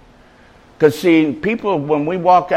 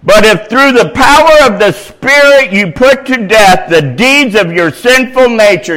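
loud, high proclamation; ringing pulpit reading; preaching scripture; 6 semitones higher, 6 dB louder than usual. Acted. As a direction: declaim the verse at full force. Reads scripture at full force.

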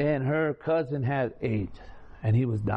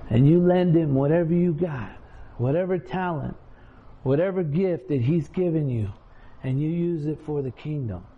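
preaching; 2 semitones lower than usual. Real, not acted. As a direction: drive it home to the congregation. Preaching climax.

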